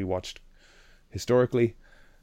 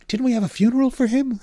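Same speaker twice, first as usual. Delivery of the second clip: high confused voice; 6 dB louder, faster than usual. Retold or imitated. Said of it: "He's kind of obsessing over it".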